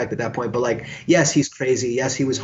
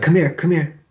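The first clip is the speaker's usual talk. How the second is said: raised, higher beckoning call; calling over; 4 semitones higher, 4 dB louder than usual. Retold or imitated. Bandmate calls him over after the show, calling out. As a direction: imitate summoning someone across the room.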